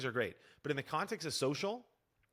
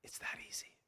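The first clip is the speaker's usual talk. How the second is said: soft whispered aside; 10 dB quieter than usual. Shown as a demonstration. Coach confiding to viewers how simple it is, whispered. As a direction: breathe it out as a secret.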